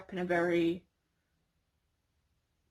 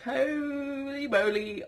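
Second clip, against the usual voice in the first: surprised; 7 semitones higher, 4 dB louder, much slower than usual. Retold or imitated. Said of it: "I was like".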